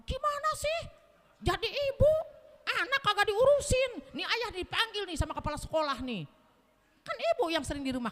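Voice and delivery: high, loud mock scolding; husband nagging his wife about the child, hammed-up. Husband blaming wife over school summons, loud high scolding.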